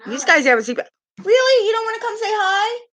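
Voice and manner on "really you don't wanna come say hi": sing-song pet coaxing. Real, not acted. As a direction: coax the pet over in a high voice.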